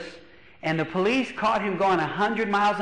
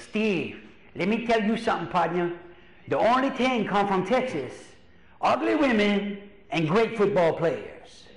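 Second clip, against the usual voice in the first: lightly hammed-up Cajun storyteller voice; Cajun accent. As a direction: imitate a Cajun uncle's drawling advice. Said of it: "he's got that accent".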